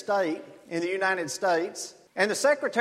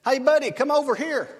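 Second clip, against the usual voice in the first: higher mock call of a joke horse; talking horse yelling; 6 semitones higher, 3 dB louder than usual. Pastor's joke horse hailing the jogger, lightly voiced high call. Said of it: "a horse yells at him and says".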